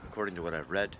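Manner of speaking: usual speaking voice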